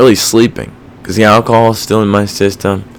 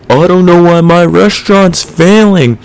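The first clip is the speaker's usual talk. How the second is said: mock delusional owner; 10 semitones higher, 4 dB louder than usual. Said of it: "Some people are like".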